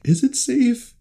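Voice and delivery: high, squeaky worried question; a pinched, high little worry; mock worried squeak. Mock worried thought about corn.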